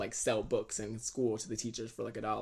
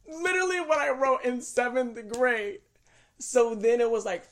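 laughing; 12 semitones higher, 10 dB louder than usual. Real, not acted. Laughing at his old lyrics.

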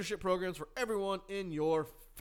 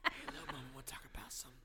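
[name] whisper; 2 semitones lower, 7 dB quieter than usual. Pretend. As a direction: whisper it like a crunk hook. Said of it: "[name] whispered".

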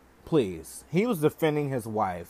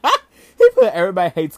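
much louder, laughing through the words; 7 semitones higher, 13 dB louder than usual. Real, not acted. Vlogger repeats the meme to viewers, laughing loudly. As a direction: crack up as you repeat it.

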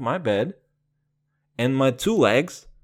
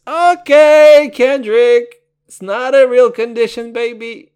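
much higher and louder exasperated yell; mock outburst; 9 semitones higher, 11 dB louder than usual. Host scolding [name] over a lyric, loud mock yell.

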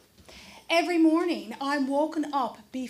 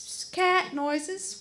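ordinary delivery

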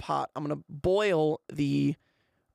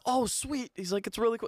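imitating a pumped dad, higher pitch; higher-pitched, delighted reaction; 7 semitones higher than usual. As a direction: react like dad thrilled about food. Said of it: "he's, like, really pumped".

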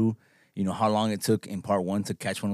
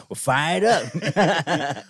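very slow, louder and higher, laughing through it; 6 semitones higher, 5 dB louder, much slower than usual. Retold or imitated. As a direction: stretch it out like a stoner rapper. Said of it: "I'm hoping [name] and [name] come and go".

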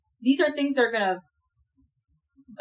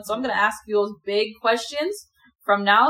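ordinary talk, nothing marked

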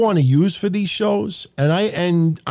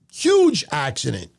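ordinary talk, nothing marked

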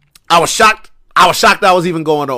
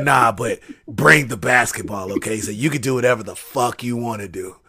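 low, soft, unhurried insistence; Moxley's real talk; 7 semitones lower, 7 dB quieter than usual. Retold or imitated. Wrestler insisting on the basketball gag, low imitated voice. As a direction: keep it low and understated. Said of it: "That's how he really talk".

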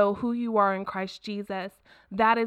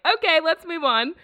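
higher and louder, perky reset; bright switch to cheerful; 7 semitones higher, 5 dB louder than usual. Retold or imitated. Podcast host shaking off seriousness, upbeat. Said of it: "I get so serious and then I'm like".

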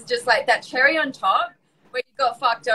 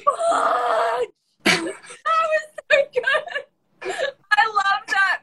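helpless high-pitched laughing speech; cracking up with delight, words stretched and high; delighted laughter; 10 semitones higher, much slower than usual. Real, not acted. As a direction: squeal it through real laughter. Listener cracks up at story.

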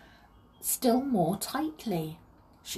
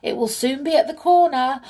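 calling out; 8 semitones higher, 9 dB louder than usual. A narrator's reading. Voice from the house reminds Molly, calling out. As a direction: call as if from inside the house. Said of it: "called a voice from the house".

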